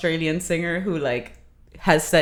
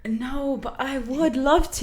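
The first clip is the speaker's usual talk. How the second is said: higher-pitched British accent; eager British accent, pitched up; 7 semitones higher than usual. Shown as a demonstration. Answering about arguing with a Brit, higher British accent. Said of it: "I can speak in it".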